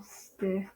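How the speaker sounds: "sti" said alone, softly, with a schwa; quiet isolated "sti"; single syllable. Shown as a demonstration. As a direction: sound out just "sti" as stuh. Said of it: "Your second syllable".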